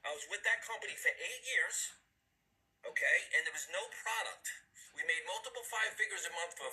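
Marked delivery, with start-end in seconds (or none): none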